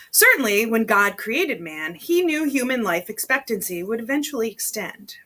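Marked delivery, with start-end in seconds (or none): none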